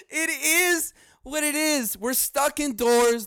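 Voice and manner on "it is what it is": high, slow whiny put-on voice; mock whine. Podcaster mocking the catchphrase, fake crying. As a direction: whimper the catchphrase.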